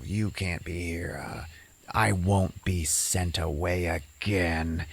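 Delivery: menacing, drawn-out threat; slow ghost voice. Retold or imitated. Ghost Diana threatening Rebecca, slow and menacing.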